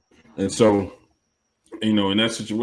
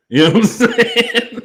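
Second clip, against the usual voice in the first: high, loud, slow laughing question; words dissolving into laughter; 13 semitones higher, 4 dB louder than usual. Real, not acted. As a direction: break into laughter while asking. Cracking up after own joke.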